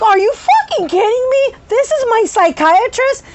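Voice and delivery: high, loud incredulous retort; outraged disbelief. Retold or imitated. Patient shouted at by her psychiatrist, outraged disbelief.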